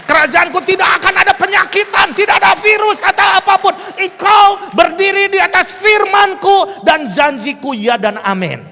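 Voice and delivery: high, full-force preaching shout; prophetic proclamation. Retold or imitated. God's promise relayed to the congregation, proclaimed shouting.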